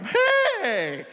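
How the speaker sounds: high, bright mock hello; cheery greeting. Red Lobster manager greeting the dad, high cheery hey.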